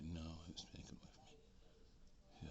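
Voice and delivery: slow hushed confession; whisper. Retold or imitated. He tells the doctor in a slow, hushed whisper. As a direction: confide it in a whisper.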